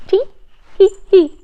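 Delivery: high playful giggle; tiny giggle. Acted. Jester giggling to get the girl laughing. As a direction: giggle it lightly to start her off.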